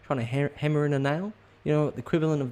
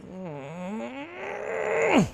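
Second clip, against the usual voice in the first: long high held hum of impatience; impatient kid hum. Shown as a demonstration. Kid waiting on parents, long high impatient hum. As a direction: hold a high whining mm. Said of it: "They just go".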